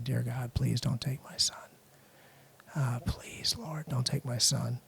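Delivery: hushed, pleading whispered prayer. Retold or imitated. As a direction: breathe the plea out in a whisper. Retold desperate prayer by crib.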